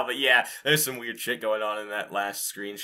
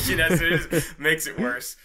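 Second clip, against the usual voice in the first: said through a real laugh, a bit louder; 6 semitones higher, 7 dB louder than usual. Real, not acted. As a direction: laugh the words out. Laughing at Pyro main joke.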